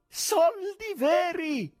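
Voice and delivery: delighted gallery crowd; joyful shout. A narrator's reading. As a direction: yell it in disbelieving joy. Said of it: "gridavano gioiosamente".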